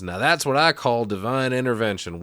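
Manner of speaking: a hint of pulpit proclamation; televangelist. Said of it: "the televangelist now says".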